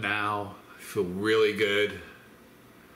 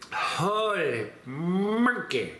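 amazed exclamation; 7 semitones higher, much slower than usual. Real, not acted. Reacting to hot pepper.

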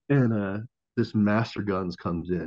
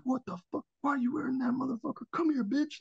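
held-back mock yell, pitched way up; mock drill-instructor scream. Drill instructors spotting recruit's shirt, mock scream. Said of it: "drill instructor screaming at me and stuff".

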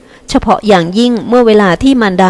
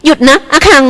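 higher sharp cry to halt; shouting to stop; 7 semitones higher than usual. A narrator's reading. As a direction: call out to stop him. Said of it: "รีเมย์ตะโกนห้าม". (ตะโกนห้ามเสียงสูง)

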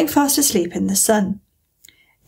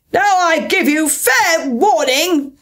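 high, slow, imperious queen's shout; storybook queen in a temper; shouted warning; 7 semitones higher, much slower than usual. A narrator's reading. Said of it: "shouted the Queen".

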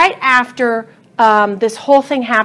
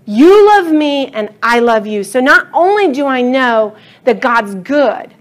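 usual speaking voice

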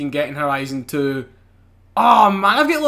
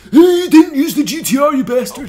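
higher and louder mock hater voice; mock angry fan; 7 semitones higher, 7 dB louder than usual. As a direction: play an outraged commenter. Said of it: "hate in the comments saying".

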